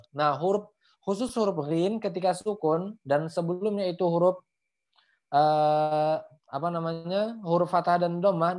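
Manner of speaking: ordinary delivery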